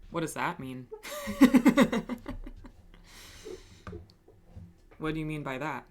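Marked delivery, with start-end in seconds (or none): 0.9-2.7 loud high-pitched laugh; burst of laughter. Real, not acted. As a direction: crack up loudly.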